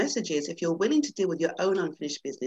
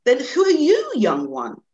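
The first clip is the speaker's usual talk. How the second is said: put-on old lion amused; 8 semitones higher, 10 dB louder than usual. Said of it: "the old lion laughed".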